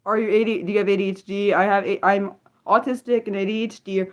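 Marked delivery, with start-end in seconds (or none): none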